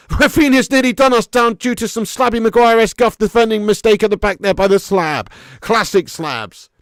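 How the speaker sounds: loud, high match-watching alarm; excited live commentary. Real, not acted. Streamer reacting to defender's near-costly mistake, loud excited commentary. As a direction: call the near miss in real agitation. Calling live football action as it happens.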